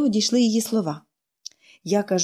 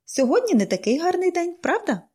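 higher, cheerful, lilting; cheerful question; 9 semitones higher, 3 dB quieter than usual. A narrator's reading. Pollyanna greeting the gloomy stranger, higher and cheerful. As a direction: brighten the grey day with a sunny question. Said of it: "весело запитала вона".

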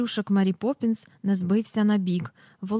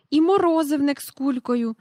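put-on high, louder girl's cry of discovery; excited call; 6 semitones higher, 4 dB louder than usual. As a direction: Jane points out the ice-cream man. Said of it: "гукнула Джейн". (висока гучніша радісна репліка)

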